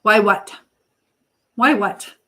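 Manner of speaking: ordinary talk, nothing marked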